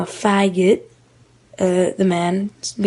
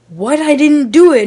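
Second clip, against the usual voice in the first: higher, louder retold denial; mock-indignant comeback; indignant protest; 7 semitones higher, 7 dB louder than usual. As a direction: act out a startled, protesting denial. Retold protest to accusing man.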